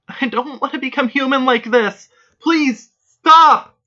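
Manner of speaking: high, loud, rising to a cry; a terrified girl screaming please stop; desperate pleading scream. Acted. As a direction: beg her to stop with everything. Girl screams, begging her stop.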